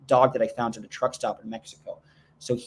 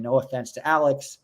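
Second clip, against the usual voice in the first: ordinary delivery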